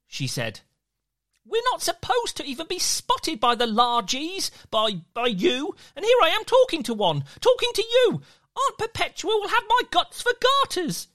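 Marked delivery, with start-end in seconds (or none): 1.4-11.1 put-on higher, quick worried little-boy voice; a fretting tiny boy who talks fast; tiny boy, anxious. Peter panicking about being seen by a largy, high and anxious.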